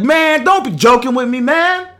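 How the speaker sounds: loud, high-pitched imitation of dad; dad's disbelieving protest. Dad to son on the phone, disbelieving protest. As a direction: act out a father refusing to believe it. Dad refusing to believe the news.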